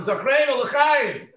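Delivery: mock congregation call, high and drawn out slowly; congregation yelling. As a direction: call it out slowly and high, like the crowd correcting the cantor. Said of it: "the Kehillah would yell at him".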